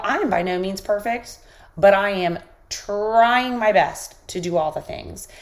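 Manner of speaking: usual speaking voice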